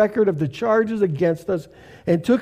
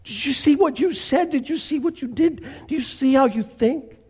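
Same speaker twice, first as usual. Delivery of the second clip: higher, gently probing voice, asking; voice of the Holy Spirit; 6 semitones higher than usual. Acted. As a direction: let the Spirit nudge the listener, a little higher. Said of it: "He says".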